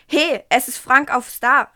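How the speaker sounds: calling out. A narrator's reading. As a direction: call it out in surprise while reading aloud. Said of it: "rief Diana".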